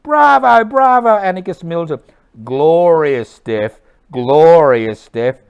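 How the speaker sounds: high cheers into slow, loud proclamation; shouting. Acted. Anarchist hailing a comrade's death, loud drawn-out shouting. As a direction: shout it high, then stretch out the glory. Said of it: "Duritti shouts".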